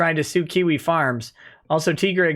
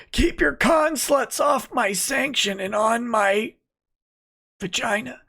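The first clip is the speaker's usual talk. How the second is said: Lemoyne; 6 semitones higher than usual. Acted. Streamer reading a viewer's line as requested, in mock character voice. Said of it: "Lemoyne voice".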